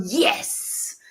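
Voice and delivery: a fist-pump yes; triumphant yes. Shown as a demonstration. Coach showing the fist-squeeze yes, triumphant. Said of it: "squeeze your fist ... and go".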